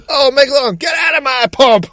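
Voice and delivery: loud, high, badly faked Cockney yell; hammed-up Cockney shout. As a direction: bellow a clumsy Cockney pub landlord's threat. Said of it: "I cannot do that accent".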